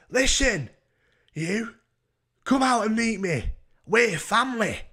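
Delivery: high, loud imitated hollering; mock drunken demand. Woman outside his bedroom door, mock hollering to relative. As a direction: holler it insistently, pitched right up.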